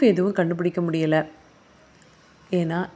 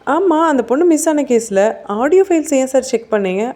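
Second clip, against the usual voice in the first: louder, higher, incredulous questioning; sharp challenge; 7 semitones higher, 6 dB louder than usual. A narrator's reading. Officer grilling suspect over the audio files, sharp challenge. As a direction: throw the excuse back at him, voice raised.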